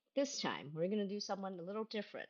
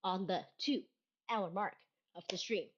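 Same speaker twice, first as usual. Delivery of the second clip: ordinary talk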